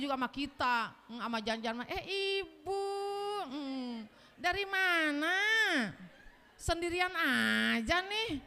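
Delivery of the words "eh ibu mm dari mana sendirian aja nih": mock sweet-talking husband, drawn out and slow; a husband sweetly chatting up a widow, lightly mocked; flirty husband. Husband charming a widow, slow and sweet.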